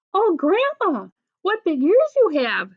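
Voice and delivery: high, swooping storybook exclamation; mock amazement. A narrator's reading. Little Red to the disguised wolf, high feigned amazement.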